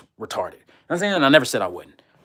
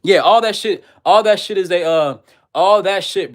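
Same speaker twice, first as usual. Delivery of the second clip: loud outburst; 6 semitones higher, 5 dB louder than usual. Real, not acted.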